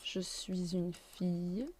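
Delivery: "suis une" linked softly with a z; liaison shown. Shown as a demonstration. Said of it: "Here, the liaison".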